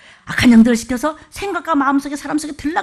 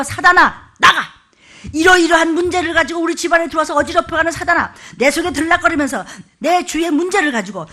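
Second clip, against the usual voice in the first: high, loud, driving command to leave; commanding Satan out; 4 semitones higher, 3 dB louder than usual. Shown as a demonstration. Pastor demonstrates expelling Satan from the household, loud and commanding. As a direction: cast it out with force, listing every trouble. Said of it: "이렇게 하라고".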